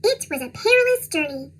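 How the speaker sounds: usual speaking voice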